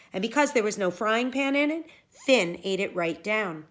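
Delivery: ordinary delivery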